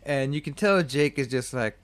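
normal talking voice

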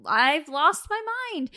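high, emphatic protest; exasperated self-defence. Retold or imitated. Explaining to boss why she stayed silent, exasperated.

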